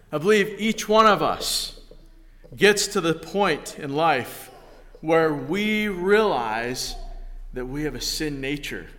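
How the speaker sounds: ordinary talk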